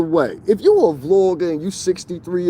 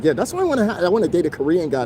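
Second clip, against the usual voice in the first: put-on higher, quicker parody of the girl; mock imitation; 4 semitones higher, faster than usual. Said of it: "So I'm like".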